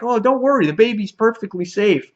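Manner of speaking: high-pitched, breezy character voice; mock reassurance. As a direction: brush the worry off in a high voice. Acting out Beast being reassured.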